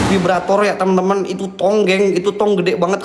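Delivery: ordinary delivery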